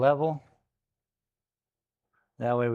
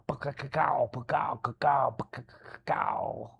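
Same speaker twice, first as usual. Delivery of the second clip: mouthed thunderclap, fading to a breathy crackle; thunder noise; 2 semitones higher than usual. Retold or imitated. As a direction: make the sound of thunder cracking outside. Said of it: "it's gonna be all like".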